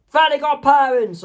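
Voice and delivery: high, loud character cry; sudden high-pitched shout; Mister Crocker's yell. Acted. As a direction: yell it out high like a cartoon villain. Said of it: "when Mister Crocker yells".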